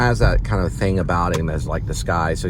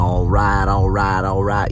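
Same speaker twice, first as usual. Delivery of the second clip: louder, lightly exaggerated Texan accent; put-on Texan accent; 2 semitones higher, 4 dB louder, slower than usual. Shown as a demonstration. Showing how a certain few Texans talk, put-on Texan accent. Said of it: "kinda like in this accent".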